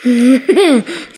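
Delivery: short high-pitched laugh; loud, high burst of laughter. Real, not acted. Laughing off-hand during filming.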